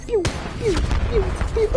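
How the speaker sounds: high pew pew gun sounds; mouth-made sniper shots; gunshot noises. Pretend. Imitating sniper rifle shots.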